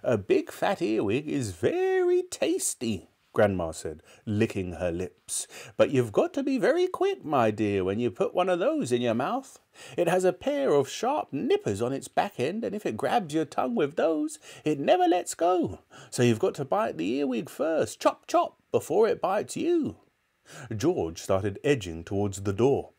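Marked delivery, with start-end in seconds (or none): 0.0-3.1 put-on high, slow old-woman voice; relishing grandmother. A narrator's reading. Grandmother relishing disgusting food. 5.8-20.0 put-on high, animated old-woman voice; gleeful grandmother. A narrator's reading. Grandmother gleefully explaining earwigs.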